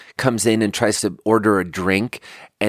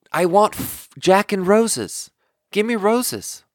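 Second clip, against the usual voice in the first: higher-pitched retold demand; acted-out insistence, pitched up; insistent customer; 8 semitones higher than usual. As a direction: mimic a customer stubbornly repeating an order.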